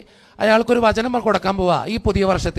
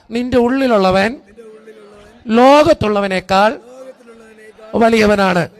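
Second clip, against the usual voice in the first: verse proclaimed louder, climbing on the middle line; 6 dB louder than usual. Shown as a demonstration. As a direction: proclaim it to the room. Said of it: "ശബ്ദത്തിൽ പറ".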